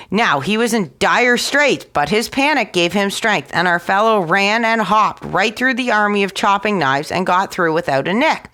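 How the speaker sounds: ordinary delivery, nothing marked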